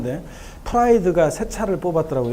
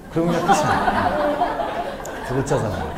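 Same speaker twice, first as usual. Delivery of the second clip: laughing. Real, not acted. Laughing at his own joke.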